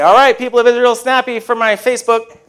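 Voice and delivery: high, loud hammed-up call to a crowd; mock calling out. Joking Joshua to people of Israel about Facebook, mock calling out. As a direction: holler it high like a joking tour leader.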